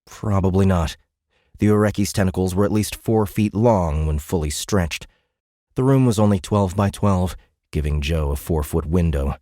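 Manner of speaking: ordinary delivery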